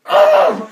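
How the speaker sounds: very high mock shriek of rage; imitated angry shriek. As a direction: shriek like a furious teacher losing it. Teacher bashing it in anger.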